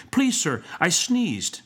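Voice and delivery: put-on high-pitched pleading child; timid, high schoolboy plea; trembling boy. A narrator's reading. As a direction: confess as a frightened little pupil. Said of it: "rejoined the boy, trembling".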